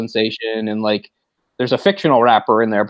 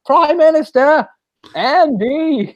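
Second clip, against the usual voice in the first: much higher, louder, slow Australian hail; Australian call-out; 5 dB louder, much slower than usual. Retold or imitated. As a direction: stretch out the greeting like a cheery Aussie. Calling the Prime Minister by the lake.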